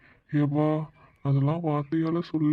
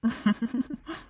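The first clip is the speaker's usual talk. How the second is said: high-pitched giggling laugh; 7 semitones higher than usual. Acted. Husband amused by wife's confession, high giggle. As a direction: burst into a short, high giggle.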